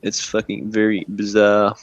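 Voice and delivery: usual speaking voice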